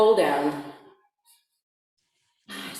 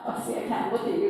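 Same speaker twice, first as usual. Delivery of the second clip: normal talking voice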